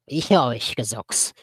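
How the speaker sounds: rasping snarl, orc voice; croaking orc. A narrator's reading. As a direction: croak it like a goblin. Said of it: "konnten wir es krächzen hören".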